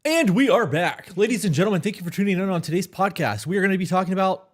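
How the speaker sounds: booming high radio host voice; big show intro, loud and high; announcer voice. Acted. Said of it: "I just wanted to sound like ... a newscaster".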